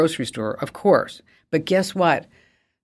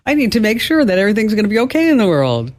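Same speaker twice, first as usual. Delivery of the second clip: high, fast, hammed-up panic over being offline; mock frantic rush; 7 semitones higher than usual. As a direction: rattle it off like a frantic internet addict. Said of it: "All of a sudden it's like".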